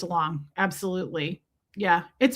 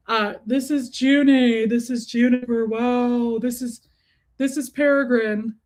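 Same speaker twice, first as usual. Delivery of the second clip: lightly put-on doggy-introduction voice; pet-talk voice; 2 semitones higher than usual. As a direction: present a beloved pet to the viewers. Presents her pets in put-on voice.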